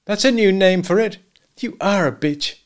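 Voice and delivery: loud, high, bitter accusation; angry husband. A narrator's reading. Husband to unfaithful wife at night, angrily. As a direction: hurl the insult at her.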